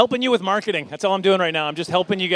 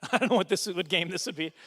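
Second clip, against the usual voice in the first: usual speaking voice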